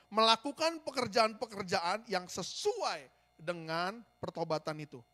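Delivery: ucapkan pelan, tekan kata "sesuai" dan "pertobatan"; "sesuai" diucapkan lambat dengan nada naik, lalu "pertobatan" ditekan; penekanan. Shown as a demonstration. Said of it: "garis bawahi". (tekankan pelan-pelan seperti menggarisbawahi)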